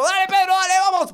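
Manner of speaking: high, loud frantic yell; exasperated, over-invested dad yelling; desperate cheering. Acted. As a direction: scream encouragement at a losing kid. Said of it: "le digo".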